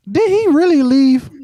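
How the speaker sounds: shouting in shock; loud shout. Real, not acted. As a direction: yell it out, stunned. Shocked the guest dropped off.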